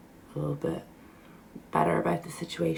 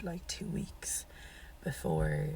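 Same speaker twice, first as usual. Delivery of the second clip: real lost voice, mostly a whisper; words pushed out through a voice that has gone; whispering with no voice left; 9 dB quieter, slower than usual. Real, not acted. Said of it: "my voice went".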